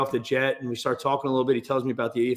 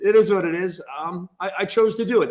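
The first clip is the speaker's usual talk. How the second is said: ordinary delivery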